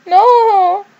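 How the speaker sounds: high, loud shout of no; a sharp, high outcry; anguished cry. Acted. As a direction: cry out in horror. Character cries out in scene.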